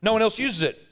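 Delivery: imitating the cleaner insisting it's their bathroom; mock cleaner. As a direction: pitch it up and whinge. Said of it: "then he goes".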